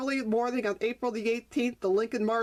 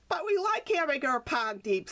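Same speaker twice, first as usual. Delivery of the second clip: protesting; 5 semitones higher than usual. Acted. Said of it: "Skip protested".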